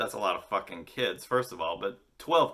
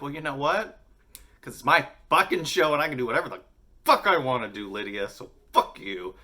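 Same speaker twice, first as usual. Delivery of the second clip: louder, playfully angry retort; mock defiant comeback; 5 semitones higher, 6 dB louder than usual. Host answering the upset fan, mock defiant comeback. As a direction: fire back in mock outrage.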